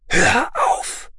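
high, strained command; urgent high-pitched plea to stop; strained mental cry. A narrator's reading. His thought cried out to inner self.

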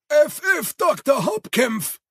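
high, eager servant call from the hall; Igor calling. Acted. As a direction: Igor answers his master. Igor announces the visitor. (hohe Igor-Stimme, rufend)

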